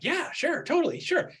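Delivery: breezy fake agreement. Trainee brushing off the coach, airy fake yes. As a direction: toss off an easy empty yes. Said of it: "And they're like".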